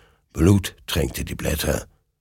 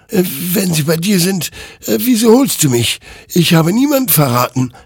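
much higher, louder protest; defensive; 12 semitones higher, 6 dB louder than usual. A narrator's reading. Hector defending himself to the rider, loud protest. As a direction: push back against the accusation. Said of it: "wehrte sich Hector".

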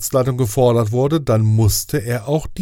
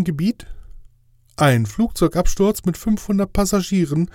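higher, incredulous angel voice; indignant inspector angel; 6 semitones higher than usual. Acted. Angel arguing with management, higher and incredulous. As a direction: protest in disbelief as the angel, pitched up.

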